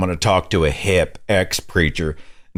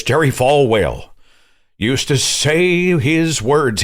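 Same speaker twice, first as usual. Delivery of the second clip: a hint of a slow preacher drawl, higher; lightly mock the preacher's pulpit cadence; preacher inflection; 6 semitones higher, slower than usual. Shown as a demonstration. Host mimics how preachers inflect, slow and higher. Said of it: "learn how to inflect their voice like this".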